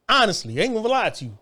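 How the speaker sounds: normal talking voice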